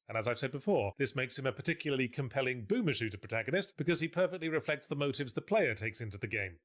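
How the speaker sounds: ordinary delivery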